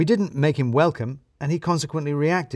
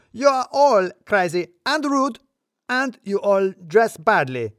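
much higher, louder, slow furious shout; full-volume yell; 9 semitones higher, 5 dB louder, slower than usual. A narrator's reading. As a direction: roar it at the whole office. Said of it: "declaring to the room".

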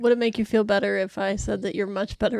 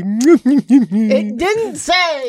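very slow and louder mimicry; mocking slow echo; 2 semitones higher, 10 dB louder, much slower than usual. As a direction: drag the line out mockingly. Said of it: "Using my own line against me".

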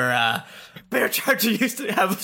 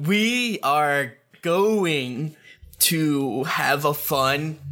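very slow, carefully spelled-out words; over enunciating; much slower than usual. Acted. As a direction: talk slowly like to a small child. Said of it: "Why are you talking slow?".

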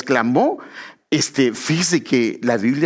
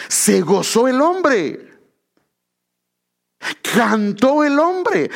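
drawn out, higher, each word weighted; slow emphatic proclaiming; 8 semitones higher, much slower than usual. Acted. Preacher proclaiming to the congregation, slow and raised. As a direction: lift it up slowly like a proclamation.

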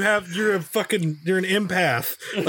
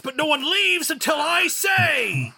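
mock villain threat; 8 semitones higher than usual. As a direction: play the tyrant teacher over the top. Villain teacher threat, shouting.

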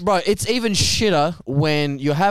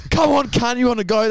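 mock fight challenge; 8 semitones higher than usual.

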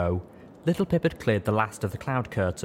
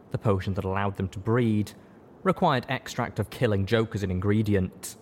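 plain reading voice

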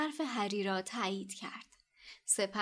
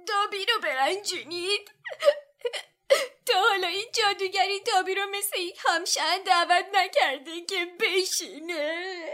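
hoarse elf voice; 9 semitones higher, 8 dB louder than usual. A narrator's reading. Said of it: "الف با صدای گرفته گفت".

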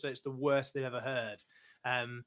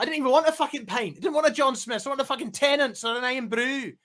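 high, loud Scottish rant; indignant comic character tirade; Woody; 9 semitones higher, 8 dB louder than usual. Acted. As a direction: yell it as an affronted, sweary Scot. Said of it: "I'm doing a Woody impression".

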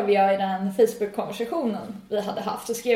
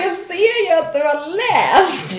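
slow, higher and louder; mock scolding; 7 semitones higher, 6 dB louder, slower than usual. To Nisse who read but ignored, slow mock scolding. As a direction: spell it out like a scold.

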